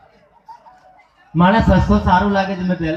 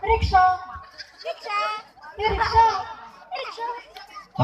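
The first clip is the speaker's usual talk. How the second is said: high thin mock call for a rickshaw, barely moving the face; lady calling a rickshaw in a high, held-back voice; careful made-up call; 12 semitones higher, 7 dB quieter, slower than usual. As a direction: squeak out rickshaw without cracking the makeup. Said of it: "makeup તૂટે નહીં ને એ રીતે રિક્ષાવાળાને બૂમ પાડે".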